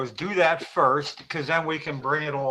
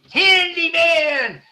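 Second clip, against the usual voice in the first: stretched, much higher, loud hero call; Handyman catchphrase; 13 semitones higher, 7 dB louder, much slower than usual. Retold or imitated. Quoting the TV superhero to cohosts, loud slow call. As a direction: belt out the hero's name slowly. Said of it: "she laughed at Handyman, the superhero".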